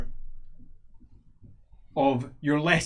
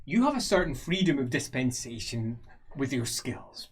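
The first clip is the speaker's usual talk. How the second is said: usual speaking voice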